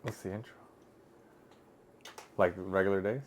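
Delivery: ordinary talk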